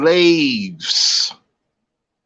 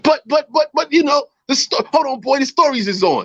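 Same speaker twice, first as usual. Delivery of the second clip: lightly voiced grandma, pitched high; retold grandma shushing a child, high voice; imitated grandmother; 9 semitones higher than usual. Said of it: "yo, she's like".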